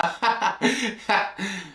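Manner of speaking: quiet giggle; soft chuckle. Real, not acted. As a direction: chuckle under the breath. Laughs at own joke.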